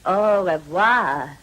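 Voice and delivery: slow, drawn-out "Au revoir"; lingering farewell. Acted. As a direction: stretch "Au revoir" out slowly, letting it hang. Parting farewell, quiet then lingering.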